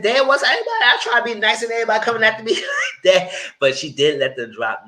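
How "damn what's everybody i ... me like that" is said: high, louder whine, laughing through it; fast, wounded complaint with a break; hurt, whiny Kiki. Acted. Kiki whining that everyone is rude to her, high laughing whine.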